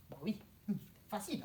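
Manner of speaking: ordinary talk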